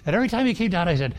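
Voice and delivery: ordinary delivery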